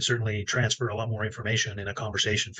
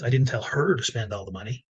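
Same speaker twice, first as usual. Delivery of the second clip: "her" stressed with a rise. Shown as a demonstration. The fourth person on stage stressing "her". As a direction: hit "her" hard.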